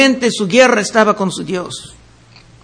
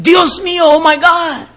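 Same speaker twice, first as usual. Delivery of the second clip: higher, mock horrified exclamation; imitated shocked gasp; 5 semitones higher than usual. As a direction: mimic people's shocked reaction. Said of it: "y dicen". (exclamación de espanto imitada, más aguda)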